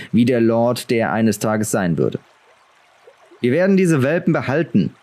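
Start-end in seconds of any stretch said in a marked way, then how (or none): 3.4-4.9 commanding. A narrator's reading. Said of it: "klang er gebieterisch wie sein Vater".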